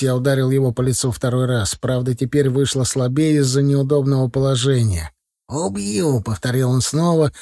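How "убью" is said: high, choked gurgle; gurgling voice. A narrator's reading. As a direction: gurgle the word through blood. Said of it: "странным булькающим голосом".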